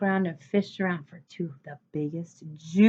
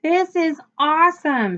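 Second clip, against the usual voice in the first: put-on excited kid; 8 semitones higher, 6 dB louder than usual. A narrator's reading. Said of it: "I said".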